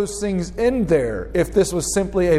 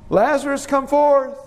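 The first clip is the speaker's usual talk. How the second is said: high, lilting summons held on a raised pitch; calling; 8 semitones higher than usual. Shown as a demonstration. Preacher demonstrating how Jesus may have called Lazarus, calling. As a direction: call out to someone some way off. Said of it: "Was it like a call?".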